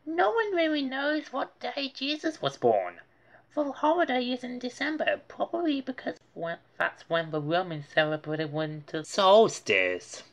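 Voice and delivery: put-on high, quick know-it-all kid; the Brain's voice. A narrator's reading. Narrator voicing the Brain explaining to Arthur. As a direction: explain it briskly like a clever kid. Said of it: "said the brain".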